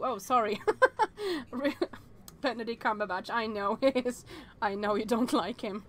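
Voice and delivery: usual speaking voice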